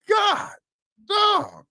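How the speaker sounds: very high-pitched mock astonishment; drawn up high, reliving the shock; shocked exclamation. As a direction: reenact a stunned reaction in a squeaky-high voice. Acting out own shocked reaction.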